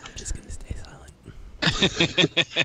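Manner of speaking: slow whisper; hushed aside. Real, not acted. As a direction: whisper it slowly into the mic. Silent guest answers the teasing.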